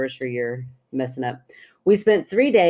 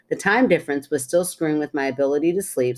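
usual speaking voice

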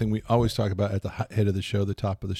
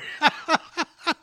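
laughing. Real, not acted. Keeps laughing.

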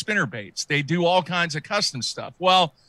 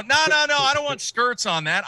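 higher-pitched picky customer; insistent correction; 8 semitones higher than usual. Acted. Customer correcting a bait shop clerk, insistent. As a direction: wave off the clerk's wrong idea, pitched up.

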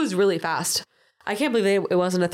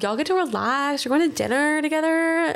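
imitating the host; 8 semitones higher than usual. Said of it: "And Jesse Palmer's like".

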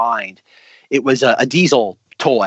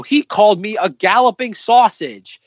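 slow, higher-pitched mock indignation; Gordon; 7 semitones higher, 3 dB louder than usual. Quoting an offended engine to a co-host, indignant. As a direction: play a pompous engine complaining of an insult. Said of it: "you have Gordon go".

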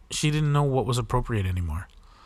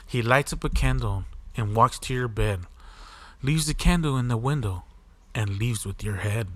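nursery rhyme; 9 dB louder than usual. A narrator's reading.

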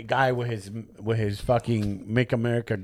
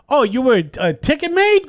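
mocking jab; 11 semitones higher, 5 dB louder than usual. Mocking fake cop.

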